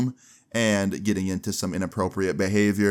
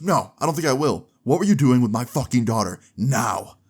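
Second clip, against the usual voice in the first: firm, slightly raised mock-dad demand; retold angry dad; 3 semitones higher, 3 dB louder than usual. Girlfriend's dad confronts the boyfriend, retold angry demand. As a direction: imitate a father demanding answers, leaning on the words. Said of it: "He looked angry".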